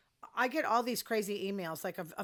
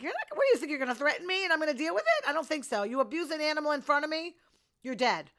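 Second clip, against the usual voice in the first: much higher, louder, fast furious rant; angry outburst; 7 semitones higher, 5 dB louder, faster than usual. Real, not acted. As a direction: let real outrage fly. Outraged rant about animal abuse.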